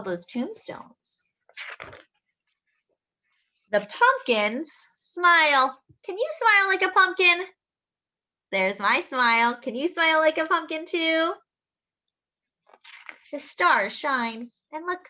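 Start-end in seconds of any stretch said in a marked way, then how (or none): 3.7-5.8 slow, drawn-out and louder; storybook reading. Acted. Reading to children, slow and drawn out. 6.0-11.3 higher, quick and playful; playful invitation. Acted. Inviting children to smile, playful and high.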